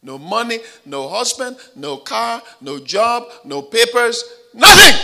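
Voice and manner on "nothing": high, loud shout on the last word; preaching shout. Acted. Preacher listing her losses, shouting the final word. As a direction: bring the list to a shouting climax.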